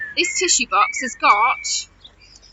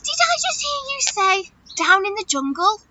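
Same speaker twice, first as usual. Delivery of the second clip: much higher pretend-astonished question; mock amazement; 5 semitones higher than usual. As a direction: act amazed at what the child said. Pretending to hear child's answer.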